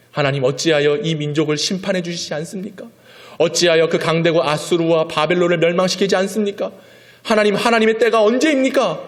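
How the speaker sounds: complaint building to high, loud crying out; Habakkuk's protest. Acted. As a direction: cry to God in frustration. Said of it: "항변하고 하나님께 외쳤던".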